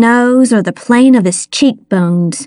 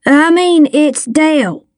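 put-on higher, mildly tearful boy; 6 semitones higher than usual. A narrator's reading. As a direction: confess it in a small, higher voice. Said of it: "his eyes filling with tears".

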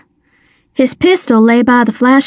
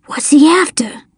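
breathy half-whisper, slightly raised; higher, hushed asking; hushed question; 4 semitones higher than usual. A narrator's reading. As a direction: whisper it while hiding from the man. Hiding boy asks a question.

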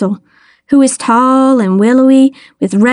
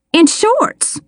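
high-pitched disbelief; scandalised exclamation without added volume; astonished cry; 10 semitones higher than usual. A narrator's reading. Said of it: "I cried".

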